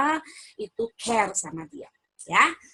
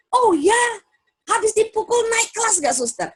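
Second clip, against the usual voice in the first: higher and louder, teasing disbelief; mock surprise; 11 semitones higher, 6 dB louder than usual. Speaker to the nun about hitting, teasing mock surprise. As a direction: feign shock to show her the point.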